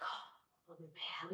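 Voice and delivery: hushed, whispered groan of dread. Retold or imitated. Narrator dreading dad's order to go fight, whispered. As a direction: barely breathe it out, as if you knew this was coming.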